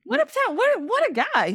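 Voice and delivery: a genuinely wowed squeal; impressed exclamation. Real, not acted. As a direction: pitch jumps way up in real admiration. Admiring the writer's achievements.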